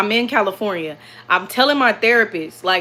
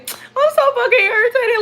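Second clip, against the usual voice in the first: exasperated wail; 4 dB louder than usual. Retold or imitated. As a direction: wail it to a partner in hammed-up frustration. Retells venting to girlfriend, exasperated.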